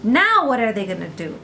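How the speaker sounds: usual speaking voice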